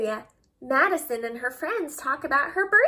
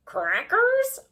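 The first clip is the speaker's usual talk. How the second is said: high parrot squawk asking for a cracker; 7 semitones higher, 5 dB louder than usual. Acted. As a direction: chirp it like a pirate's parrot. Pirate drawing, parrot.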